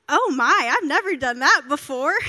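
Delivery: loud, wobbling mock panic; panicked reaction. Young preacher panicking at the last-minute request, loud mock nerves. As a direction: act out a loud, flustered freak-out. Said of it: "I'm, like, sweating".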